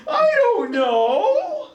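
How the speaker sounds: very high, drawn-out mock ignorance; clueless high schooler. High schooler answering the teacher, mock clueless. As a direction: imitate a teen stretching out a helpless answer.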